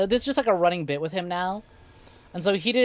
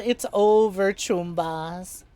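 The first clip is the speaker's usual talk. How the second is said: mock despair; 2 semitones higher, 5 dB louder, much slower than usual. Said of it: "He's like".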